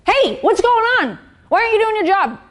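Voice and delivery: a grumpy leader taking it out on the team; irritated boss snapping. Retold or imitated. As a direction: bite at your staff out of your bad mood. Snapping at staff in funk.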